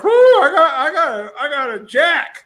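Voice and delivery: high, acted whoop of delight; excited. Man retelling his flat tire with jack on hand, excited. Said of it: "I was excited 'cause I".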